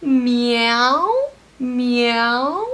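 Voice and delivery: loud playful meowing; cheerful cat sound for toddlers; cat meows. Shown as a demonstration. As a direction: meow like a kitty for the kids. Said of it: "Can you meow like a cat?".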